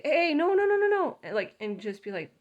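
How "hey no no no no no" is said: loud, much higher string of no's; scolding shout. Retold or imitated. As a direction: shout the dog off the donuts. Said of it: "I would say".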